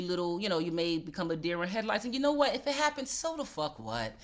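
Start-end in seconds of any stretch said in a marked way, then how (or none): none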